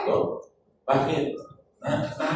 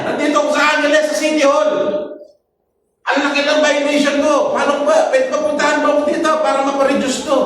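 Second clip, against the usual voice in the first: higher, fast imitation of a pleading caller; 9 semitones higher, faster than usual. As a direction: rush it like a caller begging for a favour. Caller begging for help, retold. (ginagaya ang tumatawag na nakikiusap, mataas at mabilis ang boses)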